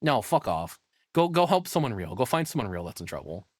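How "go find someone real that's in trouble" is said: usual speaking voice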